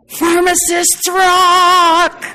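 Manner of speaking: loud rallying high shout, slowly stretched; cheerleader's rally shout; group shout. Shown as a demonstration. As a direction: lead the room in a cheer and shout it out. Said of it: "and shout".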